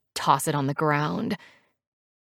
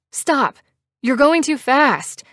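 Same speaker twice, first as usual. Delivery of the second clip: high, urgent yell; yelling over wind; 9 semitones higher, 6 dB louder, slower than usual. A narrator's reading. Passenger to biker over the rush of wind, yelling. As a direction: call out sharply over rushing wind. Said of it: "I try to yell over the rush of wind".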